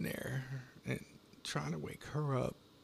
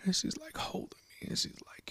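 ordinary talk

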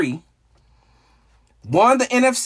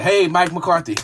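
ordinary talk

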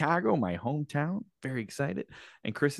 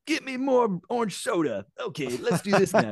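put-on bossy teen king; 9 semitones higher than usual. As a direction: order everyone around like a spoiled teen. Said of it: "being like".

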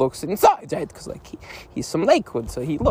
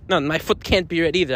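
ordinary delivery

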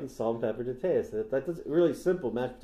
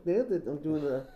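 usual speaking voice

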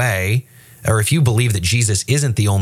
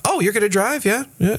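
much higher, skeptical quoted question; mock doubtful passenger; 11 semitones higher than usual. The pastor's story passenger questioning the driver, mock skeptical.

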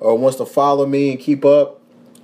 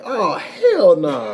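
slow laughing "hell nah"; 7 semitones higher, much slower than usual. Retold or imitated. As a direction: laugh out the disbelief. Laughing disbelief.

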